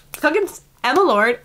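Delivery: high, loud, delighted outburst; excited exclaim. Real, not acted. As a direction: blurt out the name with glee. Gushing over her favorite author.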